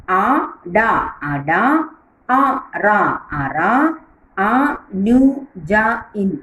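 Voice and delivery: അനുജൻ spoken very slowly, each syllable separate; teacher reading a word slowly for children to repeat; slow syllable drill. Shown as a demonstration. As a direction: spell out അനുജൻ slowly for young pupils. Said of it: "teacher drills syllable by syllable".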